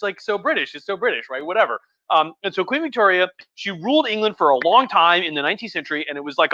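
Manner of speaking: ordinary delivery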